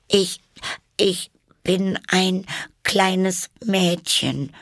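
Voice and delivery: put-on high, slow, halting girl's voice; unsure. A narrator's reading. Alice tells the pigeon what she is, unsure. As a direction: hesitate between words, pitched high. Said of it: "sagte Alice etwas unsicher".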